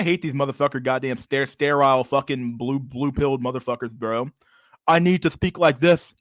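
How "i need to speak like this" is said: higher, louder mocking voice, no accent yet; mock prim voice. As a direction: sneer at the scripted YouTuber. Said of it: "I gotta put my accent on".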